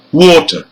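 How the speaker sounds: "water" stressed high on WA, falling after; first-syllable stress. Shown as a demonstration. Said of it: "the first syllable".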